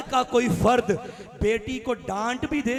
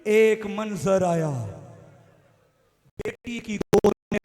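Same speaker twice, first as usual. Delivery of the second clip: lower, measured mourning narration; hushed mournful recitation; 3 semitones lower than usual. Acted. Preacher setting the scene of the father in his daughter's lap, hushed and mournful.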